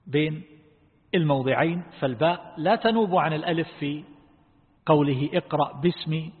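normal talking voice

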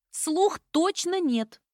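high, slow, emphatic cry; convinced exclamation. A narrator's reading. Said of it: "воскликнула убеждённо". (убеждённо воскликнула)

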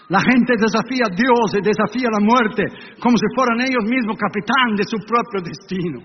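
normal talking voice

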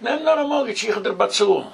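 high-pitched quoted voice; high, mock-persuasive quote; retold urging. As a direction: imitate someone urging, pitched well up. Retold urging to take a mortgage.

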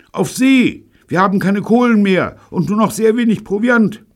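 very high, loud distress call; frantic radio shout. A narrator's reading. David radioing Neil for help, frantic shouting. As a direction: shout for help across the airwaves.

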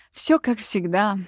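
plain reading voice

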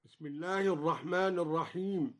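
ordinary talk

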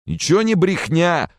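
high, loud furious retort; shouted angry denial; enraged. Acted. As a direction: snap back in a rage. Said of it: "рассвирепел Ханди".

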